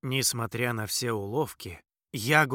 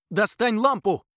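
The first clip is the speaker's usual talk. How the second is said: loud urgent command; shouting; 9 semitones higher, 8 dB louder than usual. Acted. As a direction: yell it over the noise. Shouting orders mid-chase.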